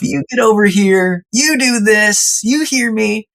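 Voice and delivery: high-pitched, imitated parental ordering; retold bossy dad. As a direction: act out a dad laying down the law. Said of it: "almost moved to win-lose tactics".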